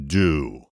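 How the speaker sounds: "do" dropped low and set apart after a pause; stress on do. Shown as a demonstration. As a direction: give "do" its own deliberate beat. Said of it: "puts the emphasis on".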